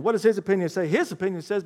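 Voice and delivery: usual speaking voice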